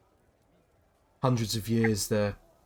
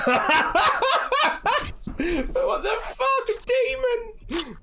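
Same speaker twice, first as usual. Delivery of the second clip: put-on much higher, incredulous drunken jeer; mocking drunk; 5 dB louder than usual. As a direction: sneer at the demon like a drunk lout. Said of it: "and then just says".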